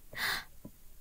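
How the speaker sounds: quick delighted intake of breath; excited gasp. A narrator's reading.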